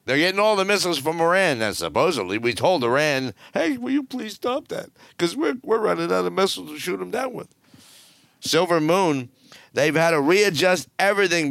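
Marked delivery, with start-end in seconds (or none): 3.5-7.5 mock pleading. Mocking US plea to Iran.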